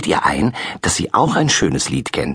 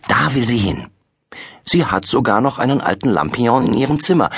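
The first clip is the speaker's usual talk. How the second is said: usual speaking voice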